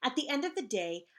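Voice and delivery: ordinary delivery, nothing marked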